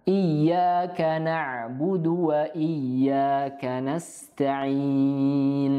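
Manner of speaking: very slow chanted verse; Quran recitation. Shown as a demonstration. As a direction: intone the whole verse slowly.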